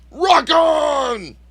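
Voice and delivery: high, loud rallying yell; shouted catchphrase. Pretend. Host signing off the show, high drawn-out shout. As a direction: belt it out like a rock frontman.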